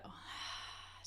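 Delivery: audible deep breath before instructing. Shown as a demonstration. Models breathing for listeners.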